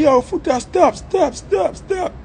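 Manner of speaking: high, rising frantic cries of stop; panicked yell. Retold or imitated. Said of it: "And I say".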